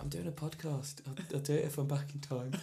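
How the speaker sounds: very soft retold excuse; hushed, hedging reply to a partner; sheepish reply. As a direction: mumble the excuse under your breath. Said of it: "I was like".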